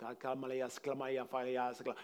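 quick stream of nonsense syllables; demonstrated tongues. Shown as a demonstration. Preacher showing listeners how tongues can sound, babbled syllables. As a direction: rattle off unintelligible syllables as an example. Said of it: "It can sound like".

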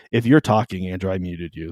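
ordinary talk

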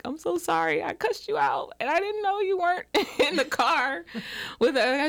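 sorry, embarrassed, laughing at yourself; apologetic. Retold or imitated. Apologizing to the stranger she yelled at, sheepish. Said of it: "I'm so apologetic".

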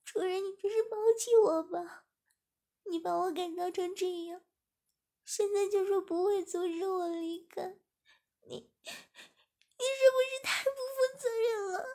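high, loud, tearful protest, voice cracking; tearful outburst. Acted. 志敏 accuses her master through tears, high and loud. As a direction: cry out the hurt like she's been abandoned. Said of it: "激动地流着泪说".

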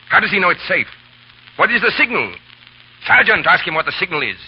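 high, loud, pressing questions; bully him into talking; harsh interrogation. Acted. Chauvelin threatening the innkeeper for the signal, harsh.

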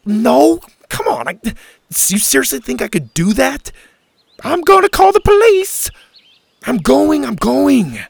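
loud, high-pitched argument; high and loud, acted exasperation; heated protest. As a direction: shout it out in escalating protest. Acted quarrel, threat to call police.

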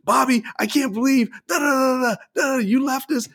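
high-pitched imitation of a nagging aunt; mock scolding rant. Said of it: "she goes at it on my uncle".

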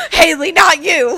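loud high gasp then outraged; betrayed by a friend; shocked gasp. Real, not acted. Friend admits loving soup.

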